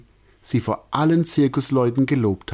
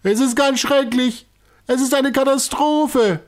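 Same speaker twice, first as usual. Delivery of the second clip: high, agitated explaining; completely flustered; 4 dB louder than usual. A narrator's reading. Director explaining to circus folk, high and flustered. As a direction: babble it in high confusion. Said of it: "völlig durcheinander".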